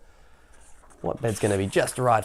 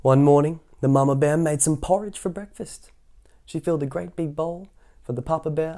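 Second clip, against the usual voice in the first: ordinary delivery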